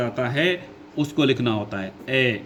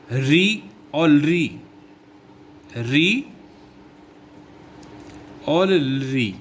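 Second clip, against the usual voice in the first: drawn-out vowels; much slower than usual. Shown as a demonstration. Teacher reading out vowel list.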